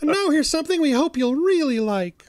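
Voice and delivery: high goofy cartoon moose; hammy Saturday-cartoon catchphrase; cartoon announcer voice. Bullwinkle catchphrase.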